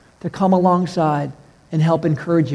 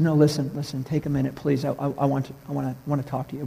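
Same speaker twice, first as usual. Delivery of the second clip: softer, hushed plea to stay; calm a crying man quietly; gentle pleading; 3 semitones lower, 8 dB quieter, faster than usual. Retold or imitated. Calming the crying clerk.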